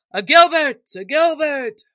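loud high cheer; crowd shouting. Acted. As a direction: cheer his name like a crowd. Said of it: "shouted all the crowd".

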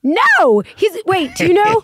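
sudden high, loud burst of protest; a delighted yelp of denial; excited no. Real, not acted. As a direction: blurt it out, thrilled to tell. Surprised denial of a funny question.

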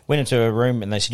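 normal talking voice

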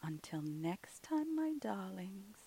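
hushed, slow, soft sign-off; soft whispered podcast outro. Real, not acted. The ghostess thanks her listeners in a soft hush.

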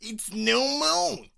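put-on loud, high, stretched-out New York accent. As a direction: drag it out in a big mock New York voice. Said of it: "shall I do a New York accent".

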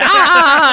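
high, loud comic scream; re-enacted scream. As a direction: imitate your own scream at full volume. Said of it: "I went".

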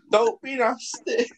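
high, slow mock telling-off; mock scolding. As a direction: girlfriends scolding him for laughing, mock chiding. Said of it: "the girls were going".

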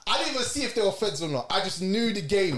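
telling it like it is happening again; excited. Real, not acted.

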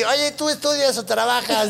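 high, silly pick-up line; chatty bread. Retold or imitated. Bread chatting up the girl, silly high voice. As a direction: ask it in a high cartoon voice. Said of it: "Bien original el pan".

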